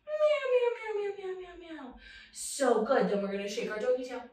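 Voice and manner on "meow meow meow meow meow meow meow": high meows fading away; cat meows. Pretend. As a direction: start high and let the meows sink and soften. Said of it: "back at your belly".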